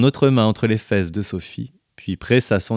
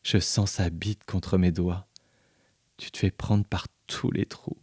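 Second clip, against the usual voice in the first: murmuring; 9 dB quieter than usual. A narrator's reading. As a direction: murmur it close to her ear. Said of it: "lui murmurer".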